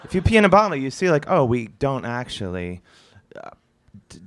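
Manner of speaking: ordinary talk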